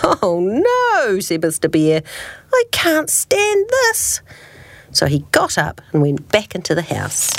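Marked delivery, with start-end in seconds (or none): 0.0-1.2 high, drawn-out swooping groan of dismay; dismayed bear. A narrator's reading. Mister Bear woken by birds and sun, dismayed. Exasperated bear voiced in story. 2.5-4.2 very high, slow wail; exasperated bear. A narrator's reading. Mister Bear still sleepless at sunrise, exasperated wail.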